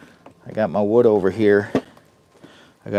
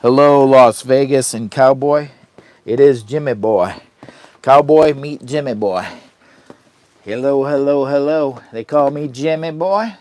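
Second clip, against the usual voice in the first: much louder, higher hello; loud greeting; 6 semitones higher, 9 dB louder than usual. Real, not acted. Greeting Las Vegas and Cowboy with a loud hello. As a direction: call out a big welcome. Greeting viewers and dog loudly.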